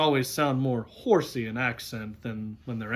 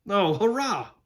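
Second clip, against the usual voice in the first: put-on high-pitched boyish hurrah; delighted cheer; 8 semitones higher, 5 dB louder than usual. Boy hearing they will go north, delighted. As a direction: burst out with glee.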